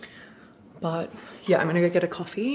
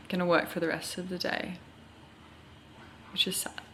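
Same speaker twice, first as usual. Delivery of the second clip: whispered aside; 8 dB quieter than usual. Real, not acted. Aside about working all day.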